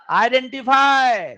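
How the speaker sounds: loud, high, drawn-out announcement; emphatic call-out. Real, not acted. Teacher announcing the key step to class, emphatic call-out. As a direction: call it out slowly and forcefully.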